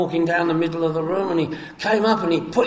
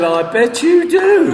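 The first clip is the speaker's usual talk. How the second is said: high, slow, laughing sarcasm; drawn-out wry jab; sarcastic laughing retort; 8 semitones higher, 3 dB louder, slower than usual. Retold or imitated.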